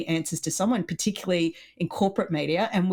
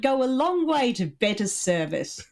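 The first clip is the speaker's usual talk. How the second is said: high, condescending upper-class put-on; mock snob; 7 semitones higher than usual. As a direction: lecture the servants in a hammed-up superior tone. Mock snob addressing the servant classes.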